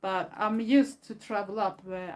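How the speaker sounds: ordinary talk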